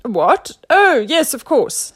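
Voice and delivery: loud, high flustered reply; startled, then covering it up; detective pretending he knew; caught off guard. A narrator's reading.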